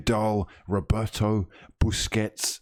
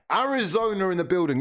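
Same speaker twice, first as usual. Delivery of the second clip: hype shout-out; 9 semitones higher, faster than usual. Real, not acted. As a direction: pitch jumps up high on Arizona, announcing it. Viewer shout-out from Arizona.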